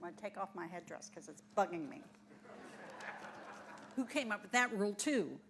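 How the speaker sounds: soft, fast offhand aside; quick aside. Real, not acted. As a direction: drop out of character and mutter it quickly. Aside out of character.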